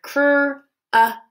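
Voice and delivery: cr-ust drawn out at half speed; slow segmenting. Shown as a demonstration. Teacher replaying sounds heard in crust. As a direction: stretch cr-ust slowly.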